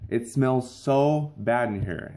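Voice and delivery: ordinary talk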